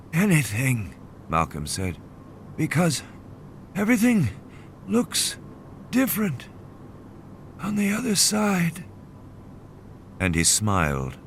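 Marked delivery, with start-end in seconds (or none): 0.1-8.8 high, floating fevered musing; delirious dreamy voice. A narrator's reading. Dying Malcolm musing to Harding about the other side, dreamy. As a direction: drift off as if already half gone. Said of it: "slipping into a terminal delirium".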